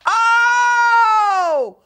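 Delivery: loud, high, long-held "oh"; a high wail of sudden realisation; drawn-out exclamation. Real, not acted. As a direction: let one big oh ring out, then fall.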